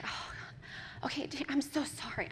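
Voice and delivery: hushed, softly acted regret; soft, contrite murmur; remorseful apology. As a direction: gently apologise to a hurt child. Remorseful mother apologising to crying child.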